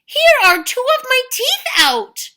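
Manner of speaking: loud, high pained outrage; outraged giant. Acted. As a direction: rage through broken teeth. Giant in pain, angry.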